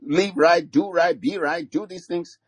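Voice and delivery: put-on a frustrated parent venting at a wayward child; exasperated parent. Mock parent pleading with a wayward child. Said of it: "I'm, like, thinking the same way".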